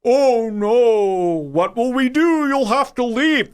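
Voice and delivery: high, hammed-up fake alarm, slowing down; mock dismay. Gas station owner's staged act, hammy fake alarm. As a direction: play a store owner putting on fake panic. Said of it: "They did this whole act".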